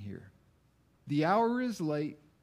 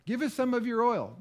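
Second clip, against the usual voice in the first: higher, faster mimicking of a begging plea; mocking the foolish virgins; 8 semitones higher, 5 dB louder, faster than usual. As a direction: parrot their request back, hammed-up.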